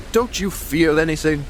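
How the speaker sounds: retold in a grave, uneasy tone; imitating a grave old sea captain. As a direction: quote the captain's grave question. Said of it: "he said to me very gravely".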